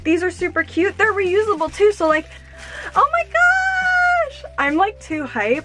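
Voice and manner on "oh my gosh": very high, stretched-out squeal of joy; excited squeal. Real, not acted. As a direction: squeal it slowly, overjoyed. Unboxing a favourite pair.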